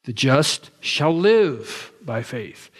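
"shall live" stretched slowly and set apart; stress on SHALL LIVE. Shown as a demonstration. Preacher showing the emphasis in Romans, stress on "shall live". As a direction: punch out "shall live" with deliberate weight. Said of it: "the emphasis is upon".

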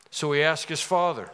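ordinary delivery

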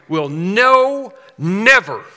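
"no" and "never" punched loud; emphatic no never. Shown as a demonstration. Preacher stressing the Greek double negative, loud and emphatic. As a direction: hit "never" hard and let it fall. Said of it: "It's emphatic".

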